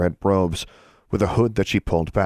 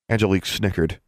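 ordinary delivery

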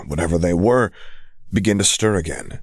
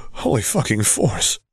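choked out; 4 semitones higher than usual. Acted. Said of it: "Quinn choked out".

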